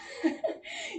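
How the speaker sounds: quick high breathy laugh. Real, not acted. Laughs mid-cardio.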